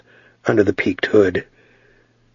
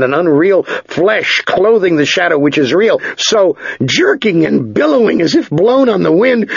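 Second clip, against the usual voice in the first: high-pitched, intense action storytelling; urgent story narration; 8 semitones higher than usual. A narrator's reading. Narrator tells of Ged battling the shadow, urgent and intense. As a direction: drive the fight forward with raised pitch.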